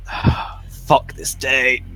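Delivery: slow, defeated grumble; lightly voiced character collapsing to the floor; exasperated groan. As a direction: give up on the day. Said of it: "I just go".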